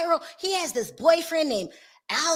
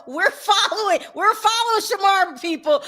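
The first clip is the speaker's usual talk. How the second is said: excited insistence; 8 semitones higher than usual. Real, not acted. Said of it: "rallying viewers".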